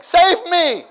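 high, loud mock cry for rescue; desperate cry. Young man in testimony pleading, loud mock cry. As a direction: yell it like you're drowning.

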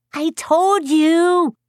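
put-on very high, loud little kid; high drawn-out child's gloating; triumphant toddler. A narrator's reading. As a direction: crow it slowly, proud like a two-year-old. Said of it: "two and a half year old".

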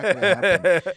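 loud, badly faked Australian accent; mock Australian accent. Admitting to the cohost he can't do it, loud mock Australian accent. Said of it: "I tried twice".

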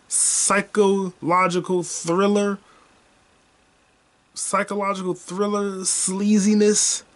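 slow, savoring each word; slow relishing delivery; drawn out. Real, not acted. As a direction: stretch the words out with relish. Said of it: "savoring the genre words".

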